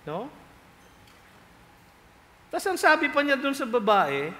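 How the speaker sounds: usual speaking voice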